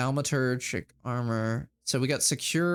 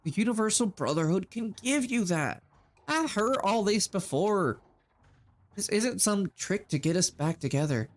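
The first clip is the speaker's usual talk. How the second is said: pitched-up, sincere sales pitch; earnest cult recruiter; 8 semitones higher than usual. Acted. Shane recruiting Terry for the Brotherhood, earnest voice. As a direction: sell her the Brotherhood.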